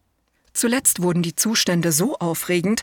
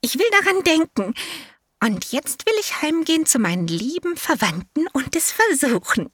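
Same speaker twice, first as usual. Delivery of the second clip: high, eager little elephant voice; a polite young elephant, pitched way up; elephant child; 9 semitones higher than usual. A narrator's reading. Elephant child answers the python in a high childlike voice.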